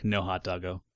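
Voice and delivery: low, soft, let-down echo; mock heartbroken. Player lamenting the missing hot dog, mock heartbroken. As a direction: sink down softly in playful disappointment. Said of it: "heartbroken about no hot dog".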